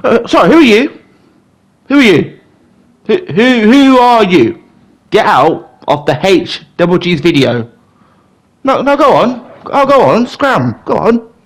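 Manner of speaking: shooing an intruder. Pretend. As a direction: chase someone out of the room.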